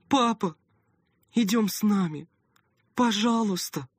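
high, begging through tears; tearful pleading. A narrator's reading. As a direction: plead with him not to go. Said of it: "со слезами взмолилась".

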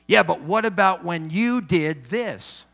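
loud defensive comeback; defensive retort. Acted. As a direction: fire it back as a counter-accusation.

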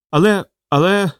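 flustered, groping for the next word; lost for words. A narrator's reading. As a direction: stammer for words, pitch lifted. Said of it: "мер ніяк не міг підібрати потрібні слова".

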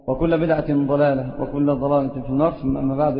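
measured, low ceremonial chant of the opening formula; formal khutbah recitation. Acted. Preacher opening his lesson to the brothers, solemn recitation. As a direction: intone the set Arabic preamble with solemn gravity.

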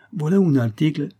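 put-on high, loud Italian-accented presenting; showy Italian merchant; old Italian shopkeeper. A narrator's reading. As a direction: present it like a proud Italian vendor. Italian returns presenting his article.

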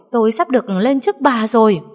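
joyful shout. A narrator's reading. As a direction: shout the good news to the whole hallway. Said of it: "rồi hét lớn".

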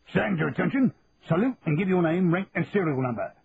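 a hint of a barking sergeant major; crisp parade-ground commands, lightly voiced; barked sergeant major orders. A narrator's reading. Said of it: "barked C S M Philpott".

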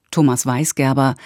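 ordinary talk

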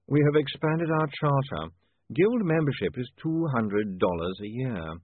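slow, deliberate official announcement; measured guild official; slight young guild chairman. Acted. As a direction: spell out the terms with drawn-out formality. Guild chairman Carney speaks.

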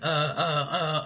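halting, repeated hesitations; lightly imitated film-star hesitancy; Jeff Goldblum. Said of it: "get away with saying".

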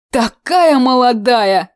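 put-on mournful sigh over the young. A narrator's reading. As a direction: sigh it out in pity. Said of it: "вздыхая".

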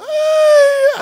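ay agudo y fuerte de niño; chillido de llanto fingido. Pretend. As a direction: chilla como niño consentido. Niño malcriado llora exagerado. (grito agudo de llanto infantil)

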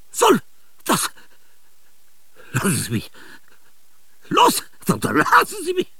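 normal talking voice

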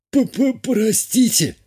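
high, drawn-out nervous stutter; stammering apology. A narrator's reading. Clumsy young healer apologises to the furious captain, stammering. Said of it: "заикаясь, пробормотал недоделанный лекарь".

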